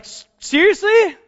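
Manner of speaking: high, loud, slow Seriously; stunned disbelief. Retold or imitated. Pastor to friend in the parking lot, loud and stunned. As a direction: stretch it out in happy shock. Friend says he's coming to church.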